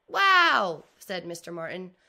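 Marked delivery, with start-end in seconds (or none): 0.1-0.9 high, loud wow; amazed wow. Acted. Mister Martin amazed by the collection. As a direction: let the wow burst out.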